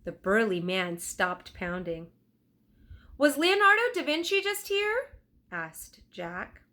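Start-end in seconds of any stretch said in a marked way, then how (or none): 3.2-5.2 put-on boy voice. Jack's voice, eager question.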